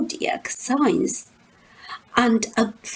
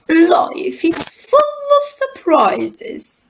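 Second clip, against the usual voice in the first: put-on much higher, crying-out old lady; high Grandmama voice; 8 semitones higher than usual. A narrator's reading. Grandmama after the banana falls, high cry. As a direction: wail it high like a dotty grandmother. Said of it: "she said".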